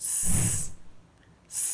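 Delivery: স said as a short s sound; teacher models the sound স; letter sound demo. Shown as a demonstration. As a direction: sound out স twice. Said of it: "এটার sound হচ্ছে".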